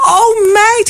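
high, teary-sounding mock gush; gushing mother. Retelling how her friend's mother gushed, high mock-teary. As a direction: ham up a mother welling up with emotion.

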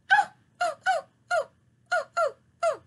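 high squeaky puppet yelps; puppet squeaks. Pretend. Puppet Brownie squeaks an answer to the host. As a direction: chatter in a tiny high puppet voice.

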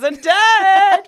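high, loud, drawn-out squeal of delight; excited squeal. Real, not acted. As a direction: shriek it with glee. Excited reaction to date news.

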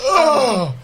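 high, loud strained groan; hammed-up lifting groan; effortful groan. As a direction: heave out a grunt of effort. Heaving up the boom pole.

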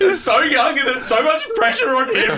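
high, breaking up with laughter; can't keep a straight face; laughing. Pretend. Comic bit, laughing.

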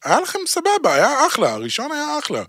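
high, pleading disbelief; exasperated. Real, not acted. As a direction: plead like a let-down fan. Complaining to filmmakers.

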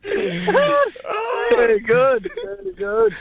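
high, squeezed out through laughing; cracking up, barely able to speak; real helpless laughter. Real, not acted. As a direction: wheeze the words out mid-laugh.

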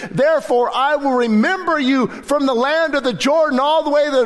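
high, impassioned preaching of the psalm; impassioned sermon climax; preaching at full force. Acted. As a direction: pour the psalm out with fervor.

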